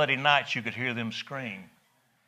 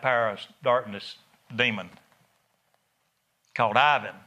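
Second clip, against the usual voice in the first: ordinary talk, nothing marked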